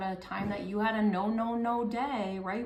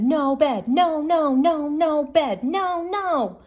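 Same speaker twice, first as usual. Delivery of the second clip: loud, higher chant of no; tantrum refusal; 7 semitones higher, 10 dB louder, slower than usual. Acted. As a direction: stamp out every no like a toddler in a tantrum. Child rolls refusing bed.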